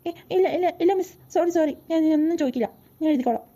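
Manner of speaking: panicked apology. Acted. Student begging the teacher not to throw him out, fast and panicked. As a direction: blurt it out before she throws you out.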